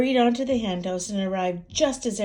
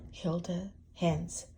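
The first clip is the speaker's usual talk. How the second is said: slow spooky title; 6 semitones higher, much slower than usual. A narrator's reading.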